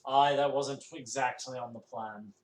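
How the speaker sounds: pirate captain's dry complaint; gruff pirate reply. Acted. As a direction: grumble like a weathered pirate captain. Captain NPC answers.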